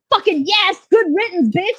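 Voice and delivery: gleeful cheer. Retold or imitated. Cheering the bishop's death.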